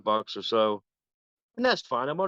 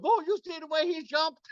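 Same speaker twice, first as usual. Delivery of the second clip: very high, amused mocking voice; mocking giggle. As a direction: tease him with delight. Dancer mocking him.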